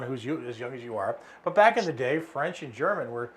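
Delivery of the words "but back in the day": ordinary delivery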